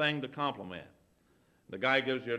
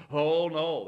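loud, higher, drawn-out protest; mock protest; 5 semitones higher, 10 dB louder than usual. Host denying it's their favorite show, mock protest. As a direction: imitate a host hastily denying it.

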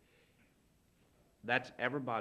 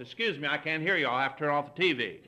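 louder, raised-voice interruption; mock can't-hear-you; 4 semitones higher, 8 dB louder than usual. Host who can't hear the visitor, raised voice. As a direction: imitate a host straining to hear over the TV. Acts out listener unable to hear.

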